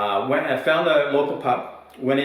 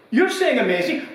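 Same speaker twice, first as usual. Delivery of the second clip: put-on old man scoffing; 12 semitones higher, 3 dB louder than usual. Old man repeats, more heated.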